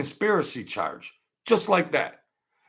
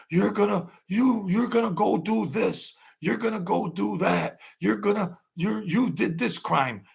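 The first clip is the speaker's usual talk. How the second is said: raised, pushy interrogator voice; mock fed shakedown, pitched up; imitating the feds; 8 semitones higher than usual. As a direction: lean on her like a cop bluffing. Feds shaking down the mistresses.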